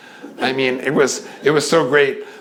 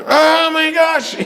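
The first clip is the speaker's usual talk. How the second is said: drawn-out startled cry, pitched up high; shocked gasp; 3 dB louder, slower than usual. Retold or imitated. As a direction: gasp it out in sudden alarm. Acted shock finding her fallen.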